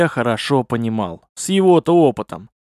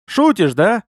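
higher, lightly amused question; amused disbelief; 7 semitones higher, 3 dB louder than usual. A narrator's reading. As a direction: check whether he is joking. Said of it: "Потом засмеялся и сказал". (с весёлым недоверием)

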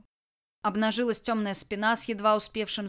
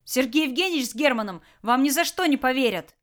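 louder, hurried outburst; boy blurting at the bandit; blurted out; 5 semitones higher, 7 dB louder than usual. Acted. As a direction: blurt it out defiantly. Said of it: "выпалил Кирилл".

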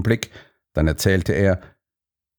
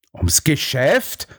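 slow, higher, incredulous echo; raised voice; 6 semitones higher, much slower than usual. A narrator's reading. Watson challenges Stanley's excuse, raised voice. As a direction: throw the words back as a pointed question. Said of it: "fragte ich laut".

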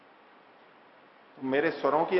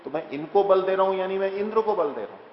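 forceful delivery; 4 semitones higher, 5 dB louder than usual. Shown as a demonstration. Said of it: "बहुत बलपूर्वक वक्तव्य बोलने चाहिए".

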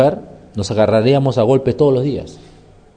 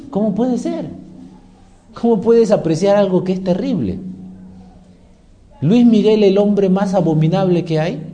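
usual speaking voice

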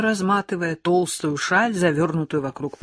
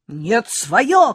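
louder defiant cry; shouting; 6 semitones higher, 9 dB louder than usual. A narrator's reading. Lyolka shouting back at Valechka, defiant cry. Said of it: "кричит Лёлька".

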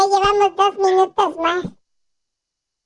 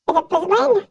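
ordinary talk, nothing marked

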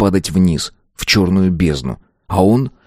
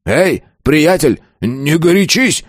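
high, drawn-out nervous plea; panicking; 8 semitones higher, slower than usual. A narrator's reading. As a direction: try to calm him in a panic. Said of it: "мгновенно запаниковал бандит". (испуганно, высоким голосом)

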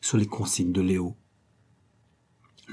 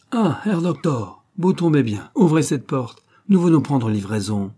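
hectoring greeting; 5 semitones higher, 4 dB louder than usual. Acted. Officer at the doctor's door with soldiers, hectoring. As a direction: hail him loudly, then order him about.

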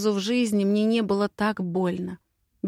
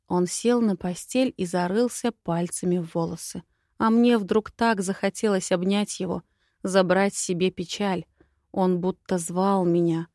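plain reading voice

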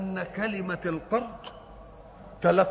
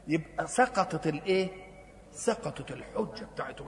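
normal talking voice

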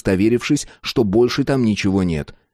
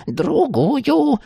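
high-pitched crone snarl; angry old woman; growled demand. A narrator's reading. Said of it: "прорычала старуха".

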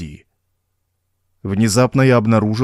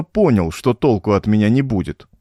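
ordinary delivery, nothing marked

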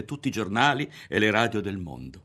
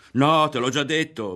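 irritated; 7 semitones higher, 7 dB louder than usual. Acted. Ario annoyed by repeated question.

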